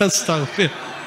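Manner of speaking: soft exclamation through laughter; real, amused chuckle into the word; laughing disbelief. Real, not acted. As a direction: say it softly while laughing. Laughing at the video.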